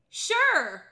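high, loud, breezy "sure"; mock-cheerful acceptance; overly casual yes. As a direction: agree too brightly, as if to ice cream. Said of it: "Oh, you wanna get ice cream?".

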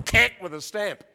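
ordinary talk

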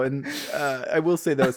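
ordinary talk, nothing marked

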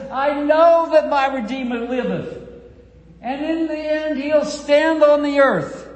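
fervent proclamation. Acted. Preacher quoting Job with fervour.

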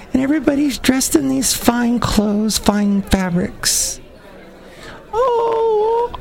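high, swooning mock gush over honeymoon photos; gushing newlywed poster. Mocking newlyweds who post honeymoon photos, high swooning gush. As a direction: coo like a smitten newlywed showing off pictures.

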